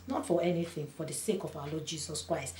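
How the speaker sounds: ordinary talk, nothing marked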